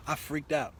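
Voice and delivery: ordinary delivery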